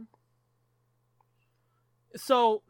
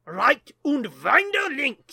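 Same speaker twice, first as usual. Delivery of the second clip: put-on over-the-top German accent, high and loud; 6 semitones higher, 10 dB louder, slower than usual. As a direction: bark it slowly like a movie German. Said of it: "Is it still okay to do a German accent?".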